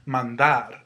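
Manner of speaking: "Mandar" with DAR hit harder; stress on final syllable. Shown as a demonstration. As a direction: demonstrate "Mandar" as man-DAR. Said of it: "wherever you hear the stress".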